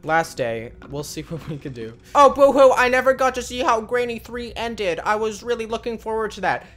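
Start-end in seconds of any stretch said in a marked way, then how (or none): 2.1-6.7 high mock-crybaby voice, loud start easing off; sarcastic boo-hoo complaint; mock whining. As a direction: imitate a disappointed whiner, pitched up. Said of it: "Said no one".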